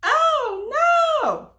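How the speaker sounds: high, loud playful "oh no"; mock dismay. Storyteller to listening kids, hammed-up high alarm. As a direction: gasp it for the kids in fun.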